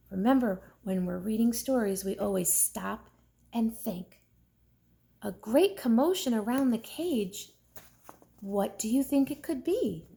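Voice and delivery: usual speaking voice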